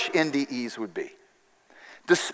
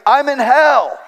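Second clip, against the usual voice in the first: higher, louder terrified cry of I'm in hell; retold scream; 8 semitones higher, 5 dB louder, slower than usual. Patient revived, screaming to the doctor: terrified cry. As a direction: shout hell in mock terror. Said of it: "he screamed".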